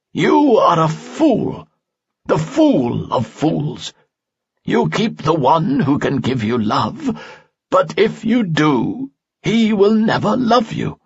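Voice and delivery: put-on the old sage condemns the spirit slowly and sternly. A narrator's reading. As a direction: pronounce doom slowly like an elder. Falsafel's voice in story.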